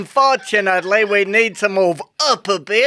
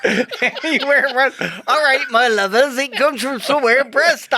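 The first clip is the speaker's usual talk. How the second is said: broad West Country Bristol accent, higher; 4 semitones higher than usual. Shown as a demonstration. Showing off his Bristol accent to his co-host. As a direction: lay on the Bristol burr. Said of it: "where do you think this comes from, from my accent?".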